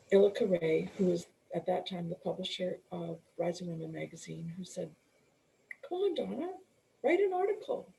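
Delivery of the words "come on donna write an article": high, coaxing friendly push. Retold or imitated. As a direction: urge her on like an eager friend. Friend urging her to write.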